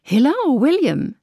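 high, bright call across the platform; excited greeting. A narrator's reading. Isabel greets William at station.

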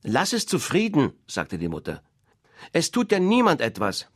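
loud, high, indignant defence; protective mother duck. Acted. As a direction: shield the duckling.

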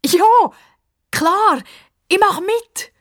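put-on high, loud, eager girl; girl happily agreeing to play; eager yes. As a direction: jump at the offer. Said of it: "ruft Pippa".

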